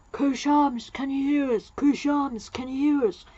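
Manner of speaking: acted hail to someone distant; calling out. Doctor hailing Ko Sharmus on the communicator, calling out.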